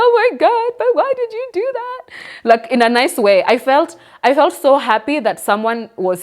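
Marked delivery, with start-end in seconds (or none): 0.0-2.0 very high-pitched mock-crying wail; imitated tearful crying. Speaker to cousin who paid for therapy, imitated tearful squeal. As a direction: squeal it like someone bursting into happy tears. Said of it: "I just started crying again".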